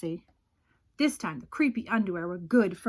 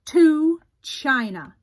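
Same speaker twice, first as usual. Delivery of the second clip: louder, slow and firm; determined call; 5 semitones higher, 7 dB louder, slower than usual. Acted. Jasper mailing the underwear away, loud and slow. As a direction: send them off with gusto.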